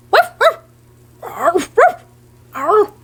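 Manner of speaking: high yappy barks; dog barking. Acted. The dog answering the child, yappy barking. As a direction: bark like an excited puppy.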